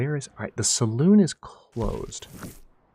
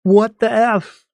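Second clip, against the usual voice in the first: shocked outburst; 12 semitones higher, 6 dB louder, much slower than usual. Acted. Echoing game character's outburst.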